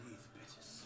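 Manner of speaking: whispered grumble. Retold or imitated. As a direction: grumble it under your breath. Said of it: "you know what he gonna say".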